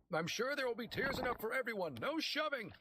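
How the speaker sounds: calming a shoving crowd. A narrator's reading. Pete calming pushing crowd.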